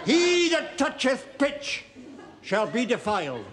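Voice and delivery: pulpit intonation. Acted. Vicar intoning scripture at Mannering.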